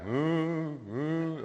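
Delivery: high, put-on cow moo. Storyteller voicing juggled cows with a high moo. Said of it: "the cows are going".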